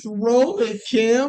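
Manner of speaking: urgent call-out. Acted. Acting out Ron calling to Kim.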